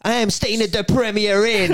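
high-pitched comic proclamation; put-on bam boast; mock bam. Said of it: "Imagine you walking about Carlisle".

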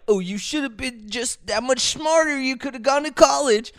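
high mock-know-it-all voice; mocking critic. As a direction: parrot the smug advice. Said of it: "everyone wants to say".

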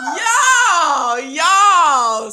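loud laughing "yo, yo"; laughing shout. Real, not acted. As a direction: yell it through a laugh. Reacting to curtain ripped back.

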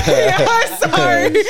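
high, slow, drawn out through laughter; laughing mock apology. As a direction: apologize while cracking up. Retells apology while cracking up.

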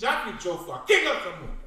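loud, high proclamation of his title; grand royal announcement. Acted. Prince proudly announcing himself to the host, loud and grand.

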